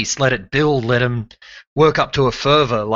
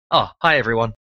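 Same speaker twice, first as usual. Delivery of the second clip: normal talking voice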